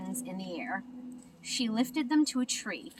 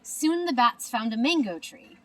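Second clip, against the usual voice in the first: ordinary delivery, nothing marked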